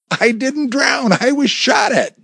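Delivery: high, slow, protesting objection; remonstrating. A narrator's reading. Husband protesting to worried wife, high and slow. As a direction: plead his case against her scolding. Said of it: "Jerry remonstrated".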